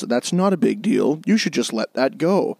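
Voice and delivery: low, slow, soothing inner voice; calm inner voice. Retold or imitated. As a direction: calmly talk yourself down. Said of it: "little voice inside his head that goes".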